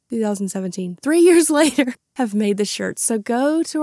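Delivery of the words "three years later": laughing. Real, not acted. Laughing at the delay.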